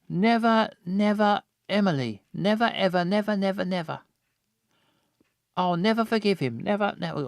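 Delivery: high sing-song, fading repeats of ever; tipsy, fading repetition; drunk slurring. Acted. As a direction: ramble it drunkenly, trailing off. Drunk character repeating never.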